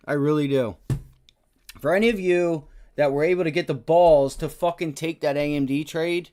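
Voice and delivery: loud, heated venting at the stock; loud, fed-up trader's rant; angry rant. Real, not acted. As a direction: shout the frustration out. Ranting at a bad trade.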